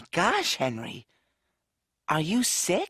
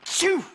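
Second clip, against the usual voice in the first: sneeze; 11 semitones higher than usual. Acted. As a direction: sneeze sharply from offstage. [name] sneezing offscreen.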